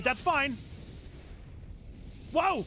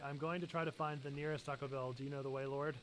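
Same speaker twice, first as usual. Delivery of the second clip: soft imagined line; 10 semitones lower, 14 dB quieter, faster than usual. Retold or imitated. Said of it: "I'd probably say something like".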